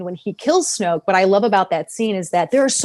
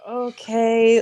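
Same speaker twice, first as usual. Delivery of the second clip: imitated reluctant agreement; mock hesitation, trailing uneasily; hesitant; 2 semitones higher than usual. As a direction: draw out a wary, unsure okay. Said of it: "the way Ben hesitates".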